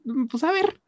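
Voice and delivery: high, scheming "let's see"; high sly musing before a prank on his sisters; curious mischief. Retold or imitated. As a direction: tease it out like a kid about to prank.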